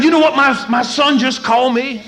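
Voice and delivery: high, loud outraged tattling; worked-up, offended outburst; indignant complaint. Retold or imitated. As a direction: act out an affronted parent on the phone. Indignant complaint to sponsor, retold.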